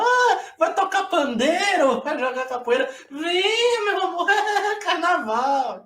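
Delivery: very high, loud, laughing swoon; swooning gringa. Retold or imitated. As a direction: gush like a smitten foreign woman.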